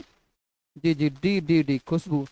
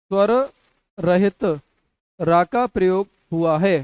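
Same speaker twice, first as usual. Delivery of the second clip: slow dictation. Shown as a demonstration. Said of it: "dictating question word by word".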